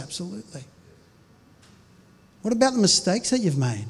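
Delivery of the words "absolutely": soft affirmation. Acted. Dropped answer.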